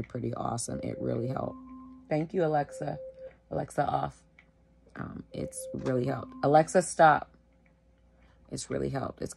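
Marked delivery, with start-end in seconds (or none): 2.1-4.2 raised, higher voice to a device; calling out. Real, not acted. Calling out to a voice assistant. 6.4-7.3 loud, higher call to a device; real impatient-sounding loud order; sharp command. Real, not acted. Repeating command to voice assistant.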